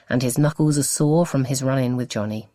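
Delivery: ordinary delivery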